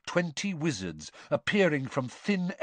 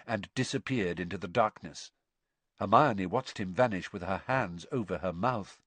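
plain reading voice

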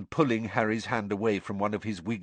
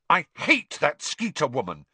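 louder, higher, furious burst; savage outburst; 7 semitones higher, 5 dB louder than usual. A narrator's reading. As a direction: spit out the hatred. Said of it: "she burst out savagely".

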